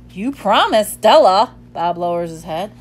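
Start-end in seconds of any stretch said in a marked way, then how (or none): 0.1-1.5 loud, accusing reminder; reproachful. A narrator's reading. Ivan scolding Bob, loud reproach. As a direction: hit him with the broken promise.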